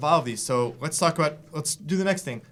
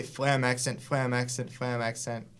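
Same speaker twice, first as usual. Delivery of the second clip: "flam accent" repeated back to back as steady triplets; spoken triplet rhythm; 3 dB quieter than usual. Shown as a demonstration. Drum teacher showing how to count triplets aloud, rhythmic repetition. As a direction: count out "flam accent" over and over without pausing. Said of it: "you could even just say".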